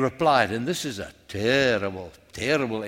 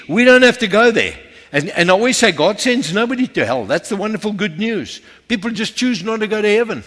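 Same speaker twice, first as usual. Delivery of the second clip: loud, raised preacher's voice; forceful preaching; 4 semitones higher, 10 dB louder than usual. Acted. Preacher warning the church about heaven and hell, forceful preaching. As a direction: preach it out loud to the whole room.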